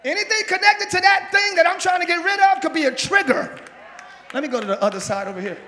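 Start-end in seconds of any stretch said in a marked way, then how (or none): 0.0-3.6 rising preacher's cry; preaching. Acted. Preacher pressing the lesson on the church, building loud. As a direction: lift it to the church.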